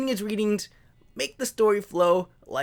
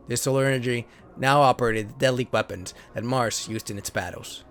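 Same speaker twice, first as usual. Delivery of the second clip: lower, steady storybook narrator voice; story narration; 7 semitones lower than usual. Acted. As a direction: read the card like an old sci-fi serial. Reads card story as narration.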